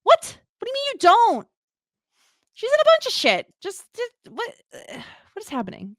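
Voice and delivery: disbelieving outburst at her friend; incredulous. Real, not acted. Outraged friend doesn't know actress.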